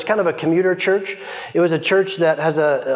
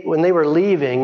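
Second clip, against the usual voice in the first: normal talking voice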